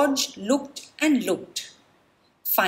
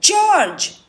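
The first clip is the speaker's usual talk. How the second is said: much higher, much louder joyful cry of a name; exclaimed; 10 semitones higher, 10 dB louder than usual. Acted. Man with the yellow hat spots George, loud and high. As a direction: shout the name in glad surprise. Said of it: "exclaimed the man".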